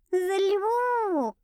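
high, drawn-out seductress tone; seductive drawl. A narrator's reading. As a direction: purr it like a temptress. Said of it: "протянула она таким тоном, словно была той ещё соблазнительницей".

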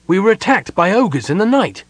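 high, insistent explanation; impatient. A narrator's reading. Sylvan explains the ogre attack, impatiently. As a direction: press the facts on them impatiently. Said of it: "Sylvan repeated impatiently".

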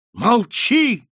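high, loud anguished cry; desperate cry. A narrator's reading. Marquise crying out, wringing her hands. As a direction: scream it out in despair. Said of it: "вскричала маркиза".

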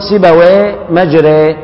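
"سيبويه مجري" slowly, both with the same e sound; match "مجري" to "سيبويه"; slow linked demo of "سيبويه" and "مجري"; paired model words. Shown as a demonstration. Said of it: "تقول".

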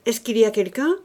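brusque shouted question; calling out. Acted. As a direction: call out to whoever is there. Head calls out into the night.

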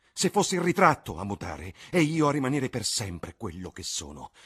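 put-on high, loud anguished young man near tears; jealous Dorian. A narrator's reading. Dorian raging at the portrait he envies, loud and anguished. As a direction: let envy and terror pour out.

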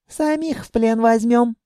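high-voiced child musing dreamily; dreamy drawl. A narrator's reading. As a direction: daydream aloud in a little girl's voice. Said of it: "мечтательно протянула она". (мечтательно, детским голосом)

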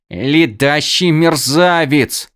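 slow growled insult; growled warning. Acted. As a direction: spit the words out slowly. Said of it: "прорычала крадунья и сплюнула".

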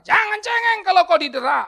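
high, loud preaching shout; shouted rebuke. Real, not acted. Preacher telling believers not to whine when flogged, full shout. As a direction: let the repeat explode.

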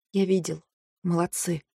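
very soft, spent voice; weak murmur. A narrator's reading. Ailing Каро praising the heroine, weak and quiet. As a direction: barely voice the praise.

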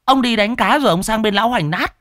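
high, loud sarcastic nagging; mock him, loud and high; sharp-tongued wife. Wife scolding husband again.

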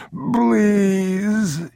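high drawn-out plea; calling out. A narrator's reading. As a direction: cry up the stairs begging. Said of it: "he called".

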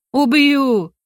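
high, drawn-out threat; furious yell. A narrator's reading. Arachnid threatens to kill the heroine, furious yell. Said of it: "заорала она".

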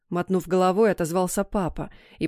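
plain reading voice

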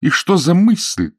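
high, loud, drawn-out indignant cry. A narrator's reading. Woman scolds fiancé over his idea, crying out. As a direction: exclaim it slowly, pitched right up. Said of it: "вскричала она".

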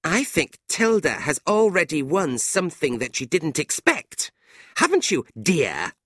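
high, catty insinuation; nasty. A narrator's reading. Fanny accusing her friend of winning Nicholas, nasty. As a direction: needle her, pitched high until the last word. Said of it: "Fanny said nastily".

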